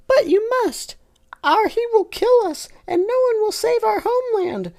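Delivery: very high squeaky insistence; insistent Tinky Winky. Acted. Tinky Winky insisting to Lala, very high. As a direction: heroic toy squeak.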